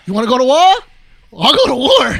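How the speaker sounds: loud, hammed-up challenge; mock battle cry. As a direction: shout it like a play fight.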